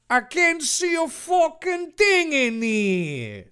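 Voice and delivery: high-pitched wailing complaint; Dave. Acted. Dave can't see in the dark cave, a high wail. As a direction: whine it way up high, trailing down at the end. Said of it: "Dave said".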